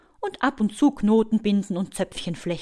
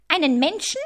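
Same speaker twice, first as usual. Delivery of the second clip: high, horrified spider screech; aghast, high-pitched outcry; screeched disbelief; 9 semitones higher, 4 dB louder than usual. A narrator's reading. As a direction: shriek the question in mock horror. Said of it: "kreischt die Spinne".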